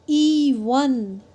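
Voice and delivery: "ई वन" slowly with the ee held; long, loud vowel. Shown as a demonstration. Teacher showing that E is open, "ई वन" with long loud E. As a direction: stretch "ई", then add "वन" separately. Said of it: "and it's loud".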